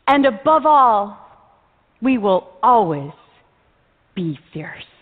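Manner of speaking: slow, dropping to a hushed end; dramatic closing line. Acted. Final line of the speech.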